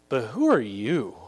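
measured, lightly voiced challenge from a spirit; evil spirit's reply. Evil spirit answers the would-be exorcists, measured challenge. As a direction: answer as the spirit, deliberately paced. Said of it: "the evil spirit answered them".

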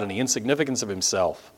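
ordinary talk

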